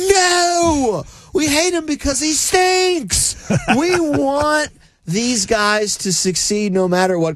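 high, slow rebuttal; indignant. Real, not acted. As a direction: hammer each word in protest.

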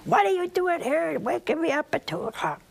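annoyed superior. Retold or imitated. Superior woken at two a.m.

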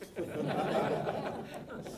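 soft voiceless chuckle; breathy laugh. Real, not acted. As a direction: laugh quietly at your own joke. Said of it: "he'll tell you".

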